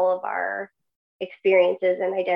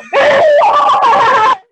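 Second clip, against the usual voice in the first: loud, high, sustained laugh; real burst of laughter; 13 dB louder than usual. Real, not acted. Guest reacting to her own grim point, laughing loudly. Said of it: "I'm laughing".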